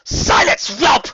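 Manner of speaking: harsh loud furious command; enraged queen; raging scream. A narrator's reading. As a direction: spit it with fury. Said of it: "screamed her rage".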